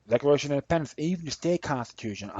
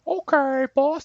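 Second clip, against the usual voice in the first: mocking a fawning underling, much higher and louder; servile yes-man; 6 dB louder, slower than usual. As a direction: squeak it out like a groveling servant eager to please. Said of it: "and have you go".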